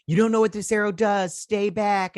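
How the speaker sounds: mock-urgent warning, pitched high; retold warning. She warns him about the arrow, retold warning.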